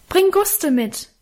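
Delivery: hoher, gedehnter Ruf; nachrufen. A narrator's reading. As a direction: call it out after someone running off. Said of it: "rief ich ihm in die Dunkelheit nach".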